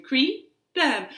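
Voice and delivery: plain reading voice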